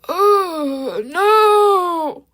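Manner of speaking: dismayed wail. Real, not acted. Reacting in horror to his fandom, loud mock wail. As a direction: wail it like it's a tragedy. Reacting to Valentino fan reveal.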